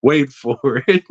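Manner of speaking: ordinary talk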